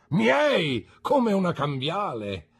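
high, loud theatrical protest; vehement, ringing declaration; emphatic exclamation. A narrator's reading. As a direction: proclaim it with great pomp. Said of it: "esclamò mister Micawber".

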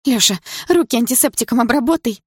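high, loud, shaky plea; trembling voice. Acted. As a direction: plead with a quivering voice. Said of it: "дрожащим голосом попросила Дилара".

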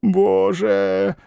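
high, drawn-out exclamation; a long high sigh of wonder; old man's awe. A narrator's reading. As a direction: marvel like a wistful old man. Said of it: "произнёс старик".

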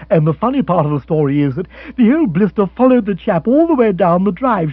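loud, gleeful storytelling; jolly Rory. Acted. Rory telling the funny part of the legend, gleefully.

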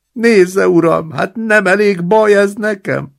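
put-on high, loud whining old man; wailing. Old man in the basin wails to his roommate, high and loud. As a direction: moan it out like a pitiful invalid.

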